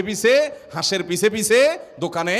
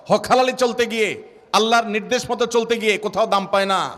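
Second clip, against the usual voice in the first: raised-pitch rhythmic litany; impassioned preaching; 4 semitones higher than usual. Real, not acted. Preacher listing the trials of the righteous to the congregation, impassioned litany. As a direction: pile up each hardship in a high, insistent preaching cadence.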